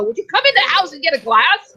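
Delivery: mock-yell it like mom calling a kid in. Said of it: "my mom would yell at me".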